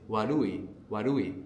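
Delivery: "warui" with the r between an R and an L; warui with soft r. Shown as a demonstration. Teacher modelling "warui" for the learner, the r between R and L. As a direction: say "warui", middle sound halfway between R and L. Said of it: "this sound in the middle is like between an R and an L".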